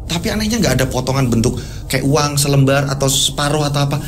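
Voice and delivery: ordinary delivery, nothing marked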